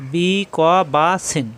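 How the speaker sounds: "বি ক বা সিন" stretched slowly, each syllable apart. Shown as a demonstration. Teacher spelling out the word for learners, slow syllable-by-syllable.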